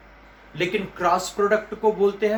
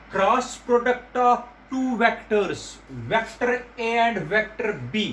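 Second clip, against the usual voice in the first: very slow, word by word; slow dictation; 2 semitones higher, much slower than usual. Shown as a demonstration. Teacher making students memorise the term, very slowly. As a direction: spell it out slowly for the class. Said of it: "याद रखना बेटा".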